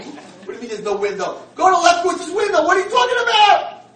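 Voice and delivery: high, loud furious shout; outraged disbelief. Retold or imitated. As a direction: yell accusing the nurse. Blind man outraged at nurse.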